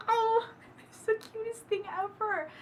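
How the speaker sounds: high, slow gushing squeal. Real, not acted. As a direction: melt over how cute it is. Gushing over the hero.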